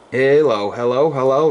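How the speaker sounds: steady test count over the air; radio mic check. Real, not acted. Ham operator testing his amp, radio check calls.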